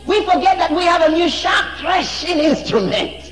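high, loud preacher's cry; soaring revival shout; full-force preaching. Acted. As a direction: preach it out at the top of the voice.

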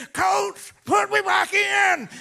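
high, loud, slow demand; tough player's shout. Retold or imitated. Injured player demanding to return to the coach, shouting. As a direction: bellow it like a battered hockey player. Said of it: "he said".

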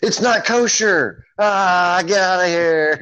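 high, loud, hammed-up yelling; mock outraged shout. Cohost plays singer caught eating hot dog, mock outrage. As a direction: wail it in comic outrage.

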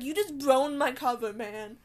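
loud exasperated complaint; annoyed complaint. Retold or imitated. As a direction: gripe that your cover is blown. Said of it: "I'm just like".

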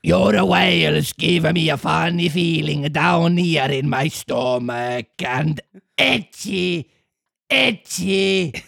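put-on very slow gruff monster in Italian accent; Italian Cookie Monster. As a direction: drag it out like a growly puppet. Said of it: "an Italian Cookie Monster".

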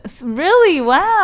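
high, loud surprised gasp. Real, not acted. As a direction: sound amazed at the gift. Surprise sauce reveal.

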